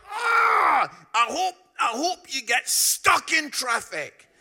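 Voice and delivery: loud, high, furious yell over the fence; angry shout. Retold or imitated. Derek yelling at Chelsea fans through the fence, furious shout. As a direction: bellow it at the rival fans in a rage. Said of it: "He was so angry".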